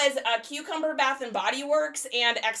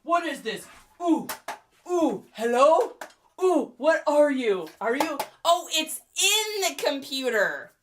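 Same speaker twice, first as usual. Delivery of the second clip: confused bro; 7 semitones higher, 4 dB louder than usual. Retold or imitated. Twenty-year-old bro baffled by the tangled cord. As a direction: play a baffled young guy poking at it. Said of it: "t-shirt bro being like".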